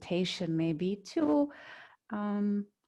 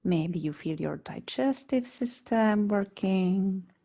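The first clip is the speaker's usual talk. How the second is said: guided meditation. Real, not acted. Yoga teacher guiding students lying on mats, calm meditation voice. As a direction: lead them unhurriedly into body awareness, phrase by phrase.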